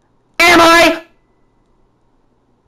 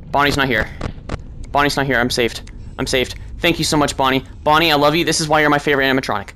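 lower, much softer relieved murmur; relieved hushed gratitude; 9 semitones lower, 7 dB quieter, faster than usual. Real, not acted. Player softly thanking Bonnie for not being at the door. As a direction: breathe it out in sheer relief.

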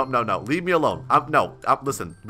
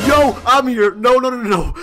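suddenly higher and louder, alarmed; startled yelp; 7 semitones higher, 5 dB louder than usual. Real, not acted. Player startled while spying on the girls, alarmed yelp. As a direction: blurt it out, caught off guard.

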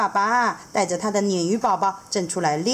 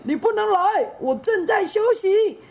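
much higher, firm refusal; grumpy stonefish; 4 dB louder than usual. Acted. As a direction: shoo him away, loud and high. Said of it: "一个人说".

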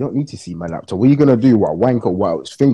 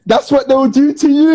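much higher, louder, slow, through a laugh; high cackling comeback; laughing retort; 8 dB louder, slower than usual. Real, not acted. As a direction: crack up while throwing it back.

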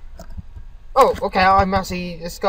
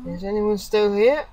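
usual speaking voice

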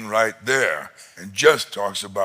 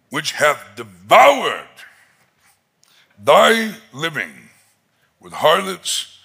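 put-on resentful older brother; 5 dB louder than usual. Older son complaining to his father, loud and bitter. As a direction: hurl it as a wronged son's grievance.

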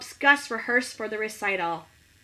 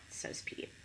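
breathy whispered narration tag; 17 dB quieter than usual. A narrator's reading. Teacher reading aloud to children, whispering the tag.

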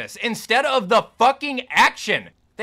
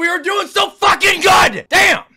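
furious shout; 12 semitones higher, 9 dB louder than usual. Real, not acted. Gamer screams at the game in fury. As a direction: yell it out in real rage. Rage at losing a fight.

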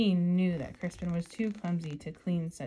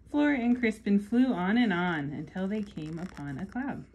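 story narration; 8 dB louder than usual. Acted. Reading picture book aloud.

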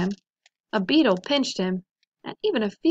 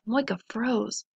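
ordinary delivery

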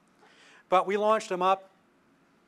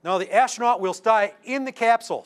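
higher-pitched put-on German accent; mock German accent; 6 semitones higher, 4 dB louder than usual. Somebody at Mission Control refusing the astronaut, in a mock German accent. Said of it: "somebody says in a German accent".

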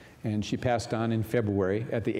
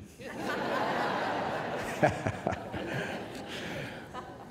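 breathy laugh; 5 semitones higher, 6 dB quieter than usual. Real, not acted. Laughing at her quip.

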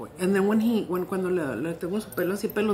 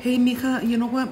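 calling cashier; 5 semitones higher, 6 dB louder than usual. Retold or imitated. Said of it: "le dije a una de las cajeras".

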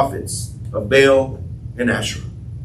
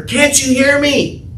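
desperate mock cry; 8 semitones higher, slower than usual. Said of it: "They screamed louder".

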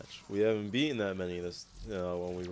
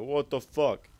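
loud, higher indignant curse; angry outburst; 5 semitones higher, 5 dB louder than usual. Retold or imitated. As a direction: snap it out in annoyance. Bitching when enemies spawn behind.

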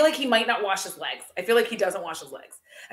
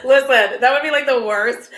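louder, laughing through it; 7 dB louder than usual. Real, not acted. Laughing loudly at the idea of Brody messaging her. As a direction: laugh out the words, much louder.

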